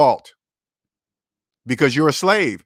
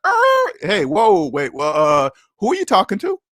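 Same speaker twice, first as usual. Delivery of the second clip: startled, squeaky jump up then a higher challenge; mock shocked pushback; 10 semitones higher than usual. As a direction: act caught off guard by the disrespect, then challenge it. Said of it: "You're like".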